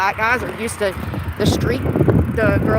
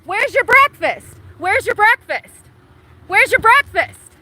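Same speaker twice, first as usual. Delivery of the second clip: higher, louder, slow furious yelling at a stranger; real angry shouting; 9 semitones higher, 4 dB louder, slower than usual. Real, not acted. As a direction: yell it at him, word by word. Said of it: "I'm out here screaming".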